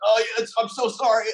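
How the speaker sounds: high, rushed mock apology; panicked apology. Friend apologizes to the angry star, high and rushed mock panic. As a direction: blurt a frantic sorry, imitating a terrified worker. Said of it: "he was just going in on him".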